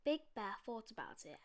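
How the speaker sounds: plain reading voice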